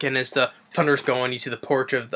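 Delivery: ordinary talk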